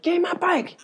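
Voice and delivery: high-pitched screech; screaming. Retold or imitated. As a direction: screech the demand like a tantrum. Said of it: "And he's screaming".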